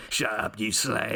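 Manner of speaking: lightly put-on East End publican accent; East End pub owner. Shown as a demonstration. Podcaster demos a rough East End pub owner's jeer, mild Cockney accent. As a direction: toss off a mild Cockney pub landlord insult. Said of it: "East End pub owner voice".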